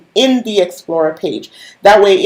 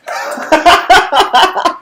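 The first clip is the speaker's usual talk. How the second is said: high burst of laughter; 11 semitones higher, 4 dB louder than usual. Real, not acted. Joking about chapped lips.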